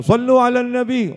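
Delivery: loud, high shout rallying the crowd to salawat; high-pitched, full-voiced call to prayer. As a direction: cry it out high and loud to a whole gathering. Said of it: "ada yang teriak".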